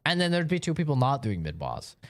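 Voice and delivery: ordinary talk, nothing marked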